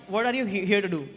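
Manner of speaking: normal talking voice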